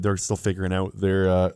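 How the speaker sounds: ordinary talk, nothing marked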